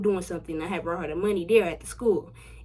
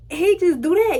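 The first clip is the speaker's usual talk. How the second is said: high pitched exclaim; 10 semitones higher, 8 dB louder than usual. Real, not acted. Telling friends about painful first time, loud and high. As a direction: voice jumps high and loud retelling the pain.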